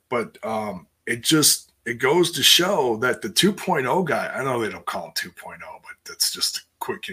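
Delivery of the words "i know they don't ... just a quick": quick whispered disclaimer; whispered aside. Real, not acted. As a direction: drop to a fast whisper for the aside. Aside explaining nickname.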